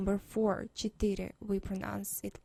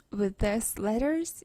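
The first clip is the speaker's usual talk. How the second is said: ordinary talk, nothing marked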